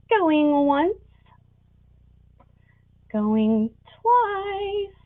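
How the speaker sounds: rising auctioneer call, going once, twice. Real, not acted.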